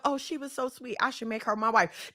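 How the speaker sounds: soft, dreamy mock inner voice; mock sweet thought. Imitating the man's imagined tender thought, soft. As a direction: soften into a pretend lovestruck man. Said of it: "they don't go home with thoughts of".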